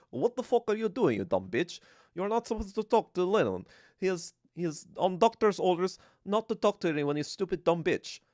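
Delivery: put-on scolding in a hammy Russian accent; mock Russian Stalin. Stalin scolding Lenin's wife over doctor's orders, mock Russian accent. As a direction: tell someone off in a made-up Russian accent. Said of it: "And Stalin was like".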